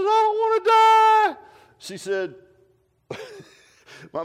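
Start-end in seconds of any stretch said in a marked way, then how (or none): 0.0-1.4 put-on high, loud wailing cry of a scared little boy; crying child. Preacher reenacting telling his mom he's scared of dying, crying loudly. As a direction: wail it like a four-year-old sobbing into the wall. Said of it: "and I'm crying like mad".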